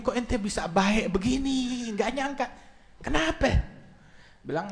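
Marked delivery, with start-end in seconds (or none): 0.0-3.5 soft, higher-pitched disbelief; surprised husband. Acted. Husband asking his suddenly kind wife why, softly surprised. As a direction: marvel softly, pitch rising. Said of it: "Alhasil dia pulang ke bini".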